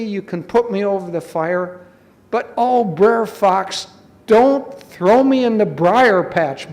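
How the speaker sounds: high, dramatic storybook begging; pretend pleading. Clever rabbit begs the fox, hammed-up pleading. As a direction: plead loudly with feigned dread.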